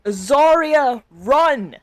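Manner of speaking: high, loud call of "Zarya, run!"; ringing call. Acted. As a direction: call out across the school, urgent. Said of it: "as the voice rings out".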